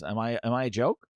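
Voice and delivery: low, soft mock menace; quiet challenge. Booty challenges HR when they fire him, quiet challenge. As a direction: imitate a wounded tough guy, quietly. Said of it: "He goes like this".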